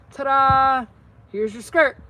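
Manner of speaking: a playful reveal. Acted. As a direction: present it like a magic trick. Showing off the finished skirt.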